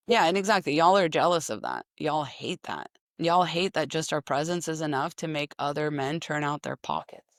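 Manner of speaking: ordinary delivery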